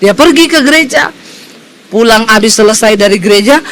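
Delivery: normal talking voice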